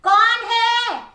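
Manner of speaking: high, loud sudden yell; shouting. Acted. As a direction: bellow it right into its ear. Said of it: "बड़ी जोर से पूछा".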